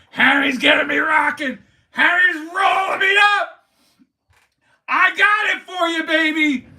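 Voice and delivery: crank it up and hype the crowd; hype shout. Acted. Fan streaming to chat, hype shout. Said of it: "I'm about to bring it out".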